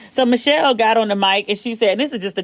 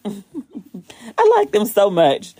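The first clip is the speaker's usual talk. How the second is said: laughing; much slower than usual. Real, not acted.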